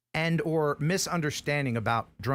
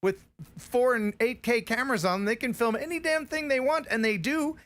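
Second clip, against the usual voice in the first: worked up; 8 semitones higher than usual. Real, not acted. Heated rant about camera rules.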